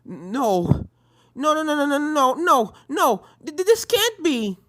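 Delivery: rising panicked cries of no, high and loud; stammering in horror, voice climbing; horrified denial. Acted. Apple Bloom sees friends dead.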